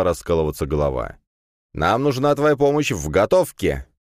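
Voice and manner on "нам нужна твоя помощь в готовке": higher-pitched, insistent appeal; calling through a door. A narrator's reading. Classmate begging help with cooking, calling through a door. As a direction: call out to someone shut in the room.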